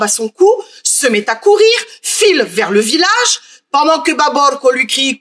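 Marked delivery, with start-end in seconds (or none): none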